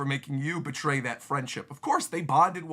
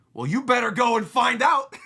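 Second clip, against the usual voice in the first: commanding shout; 8 semitones higher, 7 dB louder than usual. Acted. King ordering his officer.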